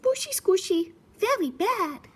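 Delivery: very high, tiny piggy; cheeky little pig refusing; piggy singsong then a stubborn nope; squeaky piggy voice. Acted. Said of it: "Said the piggy".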